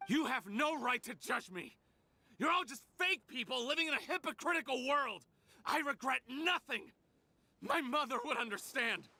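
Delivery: bitter angry rant; defiant outburst. Acted. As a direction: hurl it at the hypocrites.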